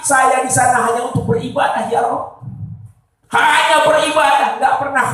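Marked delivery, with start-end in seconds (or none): 0.0-2.5 high, ringing plea to God; fervent acted supplication, pitched high; prayer to God. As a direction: call out each divine name in an insistent retold prayer. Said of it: "saya hanya bilang sama Allah".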